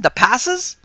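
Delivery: high, loud, rising disbelief; astonished question. A narrator's reading. Sanderson startled by the claim.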